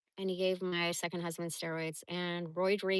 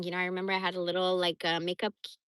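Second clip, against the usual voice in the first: normal talking voice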